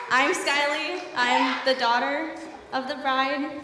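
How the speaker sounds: ordinary talk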